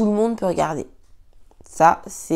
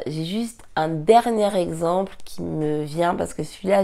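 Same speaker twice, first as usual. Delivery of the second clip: ordinary delivery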